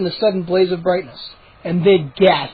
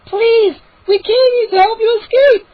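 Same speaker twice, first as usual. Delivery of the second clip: much higher, loud desperate cry; frantic plea; 6 dB louder than usual. Acted. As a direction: beg them at the top of your voice. Mindy pleading with gnomes.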